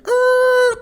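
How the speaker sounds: high squealing tire screech imitation; mock skid sound; screeching crash noise. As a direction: mimic a car screeching.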